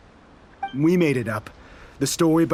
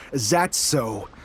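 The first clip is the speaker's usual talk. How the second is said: mocking laugh; 6 semitones lower, slower than usual.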